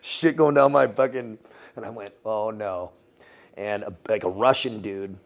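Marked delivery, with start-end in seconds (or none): 2.2-2.9 soft, understated sinking reaction; retold quiet dismay. Recalling rubble falling on him, quietly dismayed. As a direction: drop the volume for a resigned reaction.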